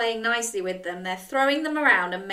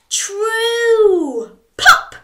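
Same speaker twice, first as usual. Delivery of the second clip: dramatic high, louder payoff word; excited reveal; 12 semitones higher, 9 dB louder than usual. A narrator's reading. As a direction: land the word with wide-eyed excitement. Storyteller builds to the wish.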